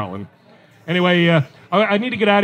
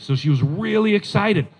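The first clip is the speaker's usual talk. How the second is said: normal talking voice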